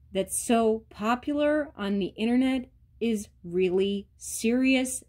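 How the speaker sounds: ordinary talk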